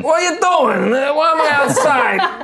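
put-on high, whiny bewildered drunk; confused drunk. Drunk asks why he is outside, whiny and high. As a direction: sputter like a woken drunk.

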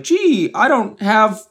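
high, slow dopey pet; mock talking dog, drawn out; stupid dog voice. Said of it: "make it all fucking stupid".

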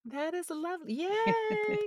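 high squealing yay of real delight; delighted cheer. Real, not acted. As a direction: cheer it up high, truly thrilled. Told of her second award.

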